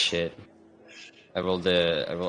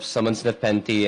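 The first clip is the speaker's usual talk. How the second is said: normal talking voice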